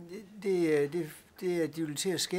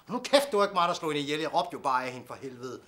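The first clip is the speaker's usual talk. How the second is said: louder, desperate insisting; frantic loud denial; 9 dB louder than usual. Acted. Man beside a dead woman, frantic loud denial. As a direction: yell the excuse in panic.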